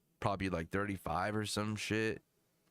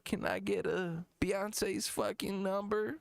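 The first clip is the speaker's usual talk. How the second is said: high, halting mock rapper; hesitant high-pitched rapper parody; 9 semitones higher than usual. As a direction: stumble through the request in a high put-on voice. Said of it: "He's like".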